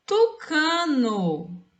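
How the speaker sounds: "Tucano" as one word, drawn out very slowly; whole word, very slow. Shown as a demonstration. Teacher giving the correct word to pupils, very slowly. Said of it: "A palavra tucano".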